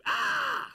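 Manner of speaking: sudden, piercing, breathy scream cut off short; a shrill, startling shriek; demo scream. Shown as a demonstration. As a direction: blast one sharp scream at the room. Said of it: "What happens when I scream?".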